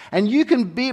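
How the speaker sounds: ordinary talk, nothing marked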